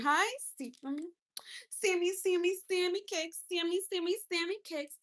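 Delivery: high, bouncy sing-song; sing-song greeting. Real, not acted. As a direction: chant the name playfully.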